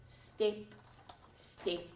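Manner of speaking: fading to a whisper. A narrator's reading. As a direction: let each step fade into a hush. Building tension, fading steps.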